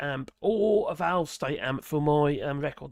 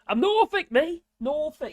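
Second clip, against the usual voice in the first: broad Norfolk, pitched up high; proud Norfolk twang, said up high; Norfolk accent; 10 semitones higher, 6 dB louder than usual. Real, not acted. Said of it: "my accent is Norfolk".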